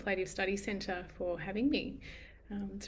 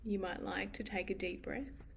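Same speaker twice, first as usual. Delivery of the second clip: unhurried, gentle meditation guiding with long pauses; guided meditation. Acted. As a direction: ease listeners into noticing, phrase by spaced phrase.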